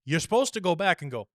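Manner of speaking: ordinary talk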